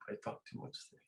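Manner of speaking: distant, off-mic aside; said away from the microphone; mic handed over. Real, not acted.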